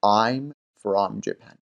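I'm from Japan, spelled out very slowly; slow demo. Shown as a demonstration. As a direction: stretch each word for learners. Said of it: "کلمهٔ from رو حتماً باید بگید". (خیلی آهسته و شمرده برای شاگردان)

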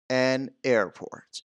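"An airport" drawn out slowly, "An" before the vowel; article demo. Shown as a demonstration. Teacher showing learners where "an" goes, slow careful demonstration. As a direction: model "An airport" slowly and clearly. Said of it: "بنابراین ان میذارم".

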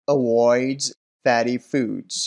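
very slow, careful "avoids" with its z ending; pronunciation demo. Shown as a demonstration. Teacher checking answer six, very slow "avoids". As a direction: stretch out "avoids fatty foods" for learners. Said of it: "طریقهٔ تلفظ درستش رو".

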